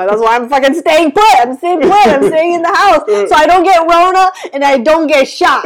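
loud, high, building outburst; emphatic rant. Real, not acted. Mock rant about staying home.

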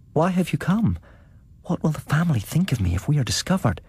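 high, quick and alarmed; startled young woman. A narrator's reading. Sedenka surprised by the narrator at her door, high and quick. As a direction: ask in a hurried, frightened hush.

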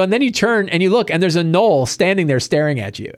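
ordinary talk

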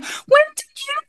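very high-pitched mock startled question; imitated surprise. Actress suddenly sees the star backstage, imitated high surprise.

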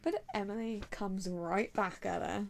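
ordinary talk, nothing marked

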